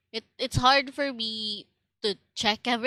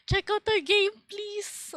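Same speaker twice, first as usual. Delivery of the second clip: sweet pleading voice; 10 semitones higher than usual. Retold or imitated. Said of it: "asking for, like".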